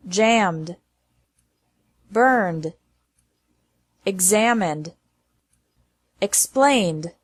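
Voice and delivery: just add d, no extra syllable; calm teacher demonstration. Shown as a demonstration. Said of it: "pronounced like D".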